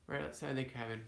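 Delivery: normal talking voice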